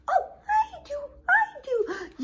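very high squeaky puppet voice; a tiny excited puppet squealing yes; high puppet voice, giggly and eager. Acted. Puppet Pepita answers teacher.

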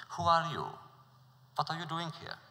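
suspicious prince challenging the newcomer. Acted. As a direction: demand it with suspicion. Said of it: "Suspicious, the prince questioned him".

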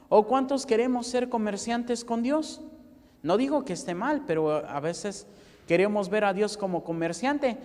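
normal talking voice

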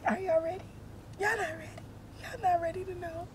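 high, soft, croaky tease; lost voice. Real, not acted. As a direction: tease them through a failing voice. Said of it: "I'm not ready to talk".

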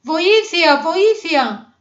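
high, loud call for help; drowning squirrel crying out; desperate cry. A narrator's reading. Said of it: "φώναξε με απελπισία". (απελπισμένη κραυγή για βοήθεια)

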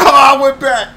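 dismayed cry. Real, not acted. Dismayed by a game mistake.

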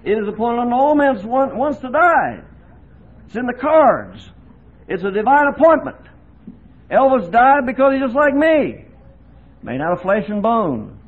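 loud, high, fervent pulpit voice; full-force preaching. Acted. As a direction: preach it out to the hall with fire.

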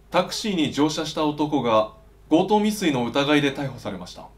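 usual speaking voice

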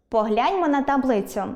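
ordinary delivery

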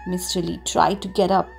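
ordinary delivery

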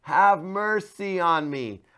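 high pleading cry, slowed down. Shown as a demonstration. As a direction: beg for mercy out loud. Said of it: "praying and crying out".

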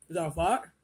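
ordinary talk

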